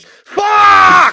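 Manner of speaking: waking late, he bellows in fury; angry shout. Retold or imitated. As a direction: blurt it out in full anger. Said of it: "Just so pissed".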